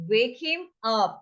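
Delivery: drawn-out teaching delivery; slow demo phrase; slow model phrase. Shown as a demonstration. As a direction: stretch it so learners can follow. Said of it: "हम क्या बोलेंगे उसको".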